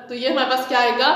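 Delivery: ordinary talk, nothing marked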